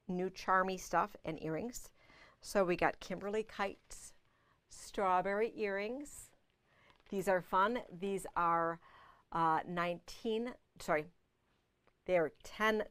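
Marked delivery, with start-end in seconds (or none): none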